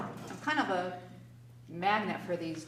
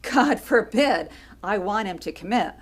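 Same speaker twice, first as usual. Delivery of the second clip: exasperated exclamation; 9 dB louder than usual. Acted. Protesting about the unsuitable boyfriend, loud and exasperated.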